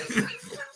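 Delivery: short high giggle; high squeal of laughter. Real, not acted. Laughs again.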